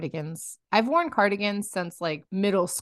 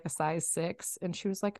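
normal talking voice

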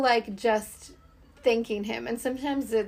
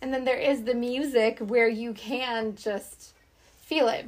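normal talking voice

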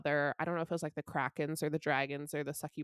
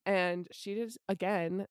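ordinary delivery

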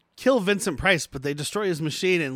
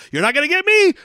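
much higher, louder cry; defiant yell; 11 semitones higher, 6 dB louder than usual. Retold or imitated. Cornered villain yelling before shooting himself, high shout. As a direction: scream it in defiance.

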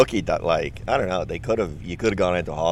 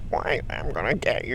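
high, soft, squeaky weakling; squeaky voice struggling with no air; little squeaky voice; 6 semitones higher, 6 dB quieter than usual. Acted. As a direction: put on a feeble little squeak. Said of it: "this little squeaky voice".

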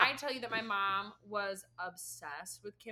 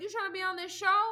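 quoting Nene; 9 semitones higher, 8 dB louder, faster than usual. Retold or imitated. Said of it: "And I was like".

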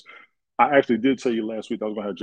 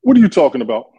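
much louder, incredulous demand; loud challenge; 3 semitones higher, 13 dB louder than usual. Real, not acted. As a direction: call him out hard. Cohost challenges his rant.

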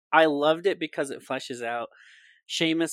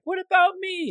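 very high, louder plaintive squeak; forlorn Neville; 6 dB louder than usual. Retold or imitated. S0 voicing abandoned Neville calling after friends, high plaintive squeak. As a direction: wail at being left out. Neville left behind, voiced.

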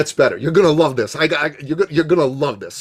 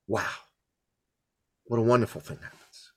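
normal talking voice

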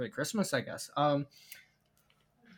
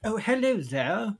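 raised, louder British greeting; British accent; 8 semitones higher, 5 dB louder than usual. Shown as a demonstration. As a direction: demonstrate a British accent on a hello. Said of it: "The British accent's like".

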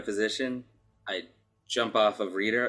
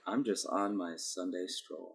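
soft, slow, breezy nonchalance; mock casual; 2 semitones lower, 8 dB quieter than usual. Owlbear faking a calm stroll, soft and unhurried. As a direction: act totally relaxed, softly and slowly. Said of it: "I'm just like".

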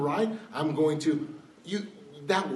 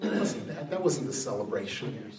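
normal talking voice

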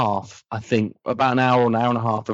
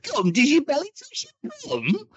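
much higher, Northern-tinged Doctor voice; thirteenth Doctor impression; 3 dB quieter than usual. In the impression-off, high Jodie Whittaker Doctor voice. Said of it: "thirteenth Doctor".

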